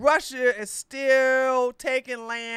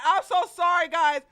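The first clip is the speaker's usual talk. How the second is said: ordinary talk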